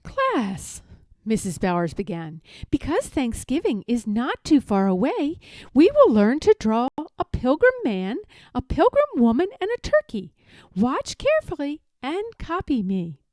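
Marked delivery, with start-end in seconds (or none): 0.0-0.8 higher sing-song teacher call; art teacher voice. A narrator's reading. Art teacher greets class. 2.7-13.2 higher-pitched chirpy teacher; cheerful art teacher. A narrator's reading.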